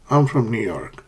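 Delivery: "I'm from New York." gliding down to the end; falling intonation. Shown as a demonstration. Teacher repeating a statement for learners, falling intonation. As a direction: let "York" fall away low.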